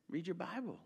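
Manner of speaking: dropped to a soft, low aside; quiet aside. Real, not acted. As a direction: slip it in softly, almost to yourself. Preacher aside to congregation.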